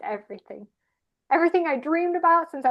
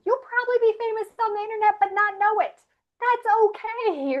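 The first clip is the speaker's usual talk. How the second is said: high, loud and bright with excitement, urging them on; excited encouragement; 9 semitones higher, 6 dB louder than usual. Real, not acted. Speaker urging listeners to embrace weirdness, excited high voice.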